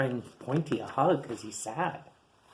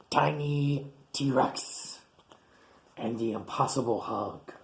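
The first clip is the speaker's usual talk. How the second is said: slow title read; slower than usual. Acted. Drawn-out dramatic title.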